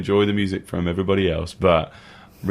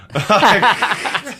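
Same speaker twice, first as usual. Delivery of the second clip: high burst of laughter; 13 semitones higher, 5 dB louder than usual. Real, not acted. Laughing at own impression.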